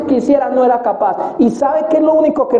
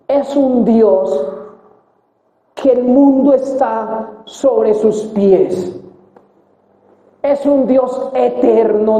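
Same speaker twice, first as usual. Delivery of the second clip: very slow, weighty words about God; slow emphatic preaching; much slower than usual. Real, not acted. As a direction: lean on each word to make the point land. (predicación lenta y enfática)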